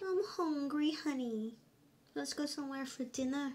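a hint of a wife asking her husband; hungry wife. Acted couple dialogue, wife. (เสียงภรรยาหิวข้าวแบบเบาๆ)

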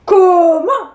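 loud, shocked witch; outraged witch. Acted. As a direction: bristle at the insult.

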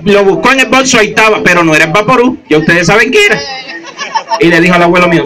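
normal talking voice

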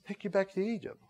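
very soft, under the breath; hushed echo. Retold or imitated. Preacher echoes the elders' plea to Egypt, hushed. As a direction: drop to a hushed repeat of their plea.

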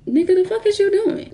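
high-pitched, incredulous challenge; startled retort. Retold or imitated. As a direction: blurt it out high, thrown off by him. Startled retort to man following her.

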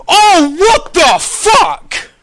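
high, loud, drawn-out wail; outraged disbelief. Real, not acted. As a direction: cry out slowly in real disbelief. Sees queue place eleven thousand.

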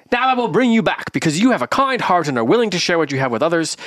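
put-on old gray man rewards Dullhead's kind heart, in the same vague accent. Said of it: "same accent I did when I was talking".

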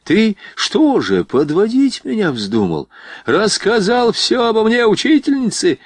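indignant confrontation; angry accusation. A narrator's reading. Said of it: "Накинулся он на солдата".

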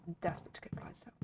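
breathy longing whisper to herself. Real, not acted. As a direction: sussurra il desiderio quasi tra sé. Longing aside about horse.

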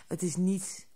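ordinary delivery

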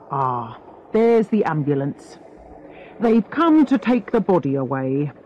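lightly voiced police inspector; a hint of a brisk detective taking charge; mild, matter-of-fact inspector's voice; inspector, businesslike.

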